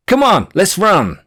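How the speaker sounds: rally her to sprint for the gate; urgent call. Retold or imitated. Five minutes before check-in closes.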